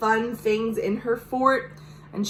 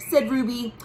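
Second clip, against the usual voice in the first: plain reading voice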